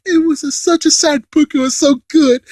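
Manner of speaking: high-pitched mock girly gushing; gushing girl. Imitating a girl who loved a sad book, gushing. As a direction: put on a high voice and gush. Book that girls actually love.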